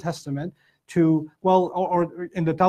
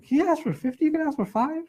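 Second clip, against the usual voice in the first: high, incredulous question; can't believe she just asked that; stunned disbelief; 10 semitones higher, 3 dB quieter than usual. Retold or imitated. Child asking mom, shocked by her lowball haggling offer.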